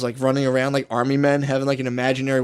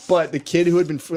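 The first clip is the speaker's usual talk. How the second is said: normal talking voice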